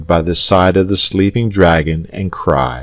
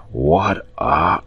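soft, drowsy dragon; murmur, drifting off mid-phrase; groggy and trailing; sleepy murmur; 2 semitones lower, 5 dB quieter than usual. A narrator's reading. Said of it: "he murmured".

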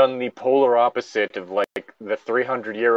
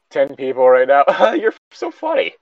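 high, louder, laughing reply; mock giggly girl; 4 semitones higher, 6 dB louder than usual. Retelling the teacher character laughing off an answer, giggly. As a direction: giggle it off like she thinks it's a joke.

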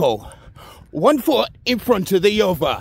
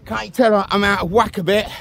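ordinary talk, nothing marked

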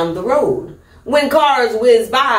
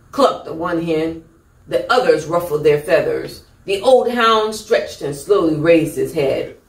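usual speaking voice

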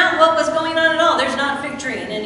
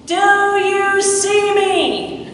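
slow, drawn-out cry, higher and louder; yelling to God; 7 semitones higher, 5 dB louder, much slower than usual. Retold or imitated. Nathanael at his lowest yells to God, slow loud cry. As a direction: cry it out slowly to the sky. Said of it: "he yells out to God".

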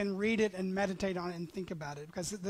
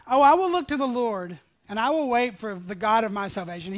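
fervent proclamation; 3 semitones higher, 10 dB louder than usual. Acted. Preacher proclaiming the prophet's trust, loud and raised. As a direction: ring it out with confidence. Re-reads verse.

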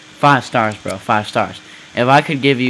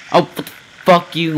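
ordinary talk, nothing marked

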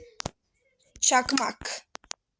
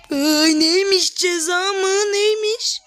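loud, high, slow mocking question; drawn-out high mock outrage; mocking shout; 7 semitones higher, 5 dB louder, slower than usual. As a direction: taunt the threat back. Loud mocking reaction to punishment.

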